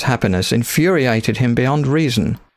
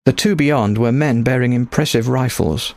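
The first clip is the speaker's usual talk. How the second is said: usual speaking voice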